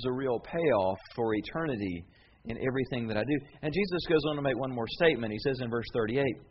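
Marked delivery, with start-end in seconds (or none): none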